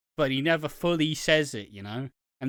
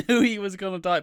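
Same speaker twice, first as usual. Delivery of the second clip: ordinary talk, nothing marked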